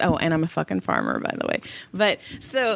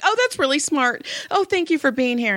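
higher, quick grateful gush; imitated gushing; 7 semitones higher than usual. Client thanks therapist for opening the door, imitated gushing. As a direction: mimic an over-eager client thanking you.

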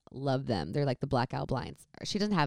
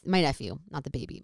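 normal talking voice

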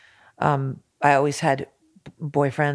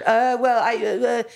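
mock stammer, pitched up; stuttering critic; 9 semitones higher, 3 dB louder than usual. Retelling how the critic stammered on the phone, mock stammer. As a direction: play the critic tongue-tied and stammering. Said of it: "He just stuttered".

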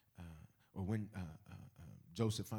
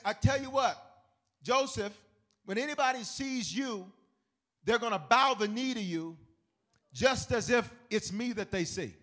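higher, louder royal decree; Pharaoh decreeing; 12 semitones higher, 11 dB louder than usual. Retold or imitated. Pharaoh decreeing Joseph's honor, loud and high. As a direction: command the whole realm. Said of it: "And he says".

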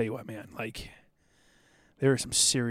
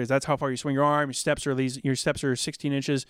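ordinary delivery

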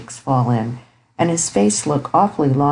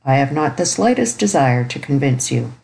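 plain reading voice

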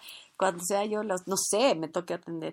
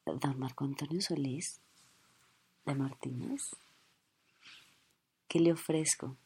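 softer, lower, lingering; starstruck hushed offer; 4 semitones lower, 8 dB quieter than usual. Acted. Flight attendant softly serving her idol. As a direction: coo at your idol. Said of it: "sería totalmente evidente".

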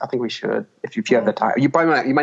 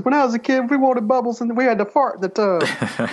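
high, excited comic storyteller voice; squeaky, giddy Eddie Murphy bit; Eddie Murphy impression; 11 semitones higher than usual. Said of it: "I'm gonna be like Eddie Murphy in Trading Places".

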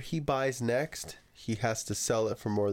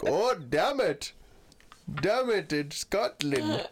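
slow, higher mock Scottish accent; 7 semitones higher, slower than usual. A friend joking about being in Scotland, in a slow mock Scottish accent. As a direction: drag it out in a hammed-up Scottish accent. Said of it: "when you're in Scotland".

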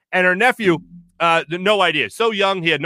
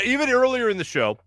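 usual speaking voice

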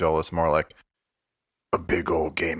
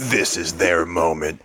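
usual speaking voice

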